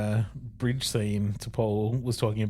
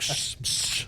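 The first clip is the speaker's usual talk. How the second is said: hushing; 5 dB quieter than usual. Retold or imitated. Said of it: "They're like".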